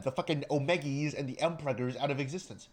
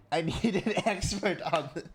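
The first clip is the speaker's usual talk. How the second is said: higher, louder, breaking into laughter; real giggles through the words; laughing; 6 semitones higher, 5 dB louder than usual. Real, not acted. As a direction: try to get it out while cracking up. Cracks up repeating own line.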